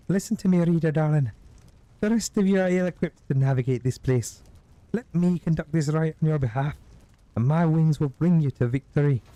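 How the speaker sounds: high, commanding creature voice; high-pitched bird-woman, bold; winged terror. Acted. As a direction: sweep them up with grand promises. Winged creature offers help.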